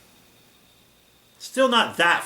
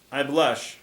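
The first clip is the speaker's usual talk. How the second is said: ordinary delivery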